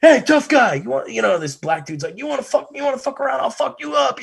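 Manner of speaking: high, loud hammed-up challenge; security guard threat. A concert security guard threatening Bam, high mock shout. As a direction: play a guard squaring up to a tough guy. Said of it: "security guard ... turns around".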